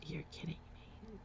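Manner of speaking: breathless whisper; whispered disbelief. Retold or imitated. To the cops explaining the law, a stunned whisper. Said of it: "I'm like".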